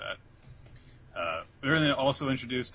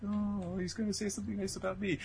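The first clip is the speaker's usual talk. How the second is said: hopeful after crying; 7 semitones higher, 4 dB quieter than usual. Retold or imitated. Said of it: "stopping crying".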